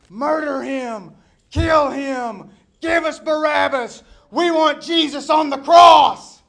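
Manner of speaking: furious, high shouting, building to full force; mob shouting. Acted. The throng turning on Jesus, furious mob shout. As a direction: roar it like a bloodthirsty crowd. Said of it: "started shouting".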